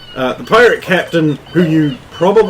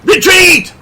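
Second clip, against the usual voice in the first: shouted order; 7 dB louder than usual. Acted. Calling the retreat.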